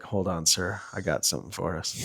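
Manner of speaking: soft, low, quick setup to a superior; low-key aside. Retold or imitated. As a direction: imitate a quiet aside before a prank. Retold aside to his lieutenant.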